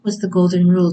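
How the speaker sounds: ordinary delivery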